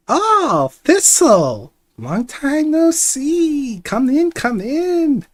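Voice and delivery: put-on high, loud, slow old woman welcome; old lady voice. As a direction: greet like a cheerful deaf granny. Herbalist greets Thistle warmly.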